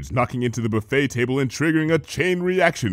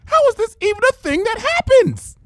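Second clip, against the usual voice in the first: exasperated outcry; 5 dB louder than usual. Acted. Shouting at reflection.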